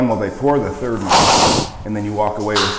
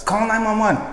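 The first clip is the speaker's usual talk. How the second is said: urgent call; 10 semitones higher than usual. Retold or imitated. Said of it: "And then I was like".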